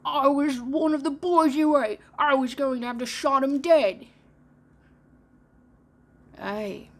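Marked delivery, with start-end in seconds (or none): 0.0-4.1 loud pirate captain. Acted. Hook's voice, loud.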